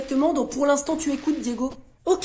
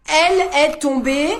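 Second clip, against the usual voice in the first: calling out; 7 dB louder than usual. Acted. Pupil calls out dictation words.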